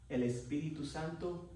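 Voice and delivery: ordinary talk, nothing marked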